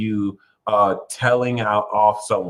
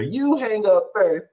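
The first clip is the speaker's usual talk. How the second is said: high, squeaky sweetheart banter, climbing higher; mock lovesick couple; 14 semitones higher, 5 dB louder than usual. Tarot reader imitating sweethearts on an all-night call, mock lovesick squeal. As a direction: play-act giddy lovebirds, ever more squeaky. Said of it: "talking to each other".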